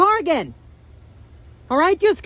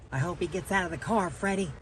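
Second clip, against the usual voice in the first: dropped way down and softer, a quick aside; hushed aside; 13 semitones lower, 10 dB quieter than usual. Real, not acted. Prankster whispering to host Freddie, hushed and quick. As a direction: murmur it fast so the man can't hear.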